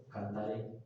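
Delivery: soft, low "Cantaré" leaning on the final é; stress on final E. Shown as a demonstration. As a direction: put the weight on the last é of "Cantaré". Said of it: "esa va como recargadita de fuerza". ("Cantaré" bajito, recargando la é final)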